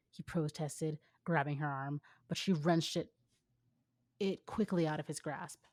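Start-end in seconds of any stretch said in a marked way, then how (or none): none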